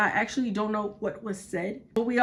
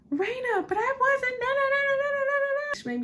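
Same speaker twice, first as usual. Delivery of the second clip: much higher, whiny pleading voice; mock panicked denial. Mimicking the scared classmate denying, high panicked pleading. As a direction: squeak the no's like a panicked kid.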